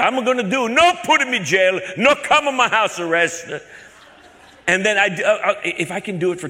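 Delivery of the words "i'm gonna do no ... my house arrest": high, loud, pleading broken English; put-on confused caller, pitched way up; mock-naive broken-English caller. Put-on caller pleading about arrest.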